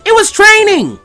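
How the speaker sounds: high, loud protest; defensive shout. Acted. Naruto on the roof, yelling his excuse high and loud.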